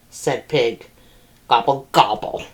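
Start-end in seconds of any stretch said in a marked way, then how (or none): 1.5-2.4 low, grumpy turkey gobble; grumbling gobble. A narrator's reading. Turkey sulking after Cow sees through him, low grumbled gobble. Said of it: "grumbled Turkey".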